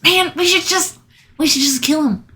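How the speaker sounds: high, loud, rushing; eager. Acted. Human urges killing the hostage.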